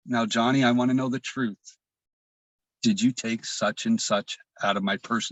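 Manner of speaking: measured, soothing questioning; calm, kind mother. Retold or imitated. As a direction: imitate a mother's suddenly gentle interrogation. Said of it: "her demeanor changed to the most calmest".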